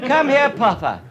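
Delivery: high, loud mock-posh call to the dog. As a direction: lampoon the posh owner calling.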